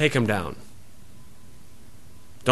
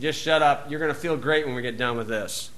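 softer, dismissive grumble; gruff father; 3 semitones lower, 9 dB quieter than usual. Retold or imitated. Noah telling son Ham to keep working, gruff. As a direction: brush off the nagging son.

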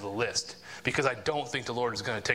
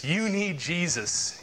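slow, higher, drawn-out mock taunt; 4 semitones higher, much slower than usual. Fools making a mock of faith, exaggerated slow jeering. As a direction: stretch the jeer out with exaggerated emphasis. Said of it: "they make a mock".

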